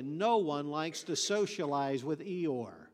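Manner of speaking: ordinary talk, nothing marked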